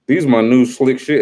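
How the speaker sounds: usual speaking voice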